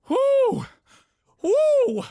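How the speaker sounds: high, loud victory whoop; loud celebratory whoop. Real, not acted. Streamer cheering the win, loud high whoop. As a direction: let out a triumphant yell.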